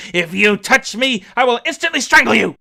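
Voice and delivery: put-on a mad woman threatening the servant girl; threatening cry. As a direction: threaten to strangle her. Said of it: "she struck her with terror by crying".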